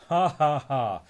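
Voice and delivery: loud mocking ha ha; gloating laugh. Gladstone mocking Donald pushing the car, loud jeering laugh. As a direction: laugh at the poor cousin.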